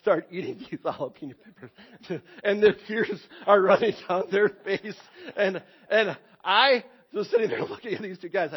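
chuckling through the memory; laughing. Real, not acted. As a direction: laugh while telling it.

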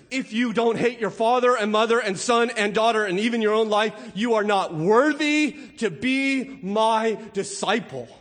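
loud, slowed, hammering preaching; full-force preaching. Acted. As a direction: drive each word home from the pulpit. Preaching Jesus' hard saying.

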